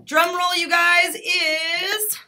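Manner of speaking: high and loud, building suspense; excited build-up. Real, not acted. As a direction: hype the crowd before the reveal. Announcing giveaway winner.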